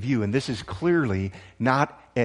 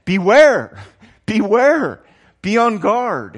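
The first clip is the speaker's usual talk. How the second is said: loud, higher, urgent beware with a laugh; emphatic warning; 7 semitones higher, 10 dB louder, much slower than usual. Shown as a demonstration. Pastor demonstrating the emphatic warning of the epistle, loud. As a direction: sound the alarm, beware. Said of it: "it's an emphatic kind of idea".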